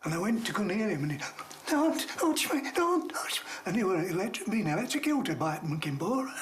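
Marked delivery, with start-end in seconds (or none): 1.7-3.5 high-pitched panicky cry; retold scream, pitched way up; imitated screaming. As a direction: act out a man screaming in shock. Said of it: "he starts screaming".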